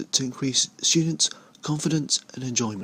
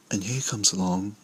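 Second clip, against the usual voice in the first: nature documentary; slower than usual. Acted. Parody wildlife narration.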